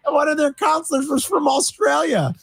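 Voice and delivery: exasperated outburst. Real, not acted. Exasperated outburst about son.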